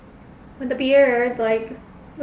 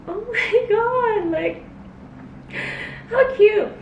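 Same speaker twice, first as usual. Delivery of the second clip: squealing over cuteness; 9 semitones higher, slower than usual. Retold or imitated. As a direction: coo and squeal at the screen. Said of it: "I was like".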